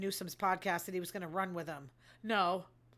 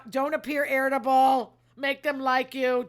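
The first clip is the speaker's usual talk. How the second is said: emphatic advice; 2 semitones higher, 7 dB louder than usual. Real, not acted.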